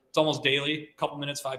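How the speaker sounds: ordinary talk, nothing marked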